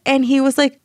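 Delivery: ordinary talk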